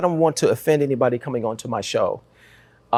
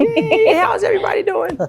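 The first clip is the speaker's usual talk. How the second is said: high put-on greeting voice, much higher; mock wave hello; 4 dB louder than usual. Host showing the guest how to greet viewers in a high voice. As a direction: squeak out a cheery hello to the camera. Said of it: "you can wave to 'em".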